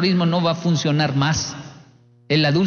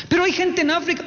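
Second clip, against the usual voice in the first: much higher, pleading mother's voice; exasperated mother; 13 semitones higher than usual. Retold or imitated. Mamá insistiendo a la niña, high pleading whine. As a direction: whine it like a fed-up mom.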